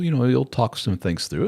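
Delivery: usual speaking voice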